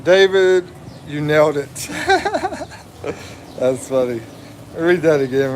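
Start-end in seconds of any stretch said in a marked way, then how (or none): 1.8-2.6 high giggle. Real, not acted. Laughing at chat joke.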